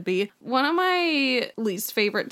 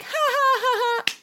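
put-on drunk giggle. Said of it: "my mom would be like".

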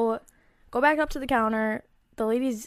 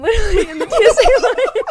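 high, loud laughing through the words; cracking up; 13 semitones higher, 14 dB louder than usual. Real, not acted. Telling friends about the security line antics, cracking up. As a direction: barely get it out for laughing.

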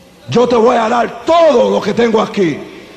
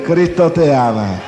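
"Cristo te ama" said lower and slowly, in syrupy mockery; mock churchy sweetness; 4 semitones lower than usual. Gang member mocking a sweet offering collector, syrupy. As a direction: parody a sugary usher.

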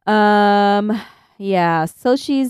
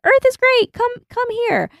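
very high squeaky yell; high goofy yell skyward; mock yell. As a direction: call up to the sky in a shrill cartoon pitch. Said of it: "He's just yelling at the sky".

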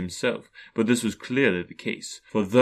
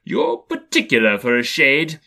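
loud, pointed retort; louder, challenging Scrooge; raised voice; 3 semitones higher, 7 dB louder than usual. A narrator's reading. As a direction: throw it back at the ghost loudly. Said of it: "raising his voice".

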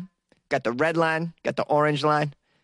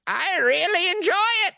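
Carrie, ancient woman; 14 semitones higher than usual. Acted. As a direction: squeak it out like someone centuries old. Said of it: "That's how she talks".

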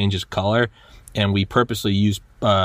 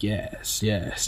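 soft, drawn-out villainous relish; hushed mock-evil gloating; evil schemer; 8 dB quieter than usual. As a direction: imitate a villain quietly savoring the plan. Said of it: "almost kinda like an evil".

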